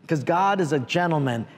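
ordinary talk

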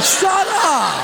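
high, laughing mock snap; mock annoyed. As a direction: brush off the teasing with a laugh. [name] answering teasing friend.

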